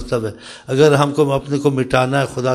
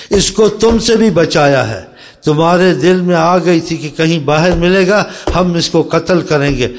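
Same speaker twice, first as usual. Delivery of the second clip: forceful preaching; 4 semitones higher, 6 dB louder than usual. Real, not acted. Preacher warning the companions, forceful raised preaching. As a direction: rise up and hammer the warning.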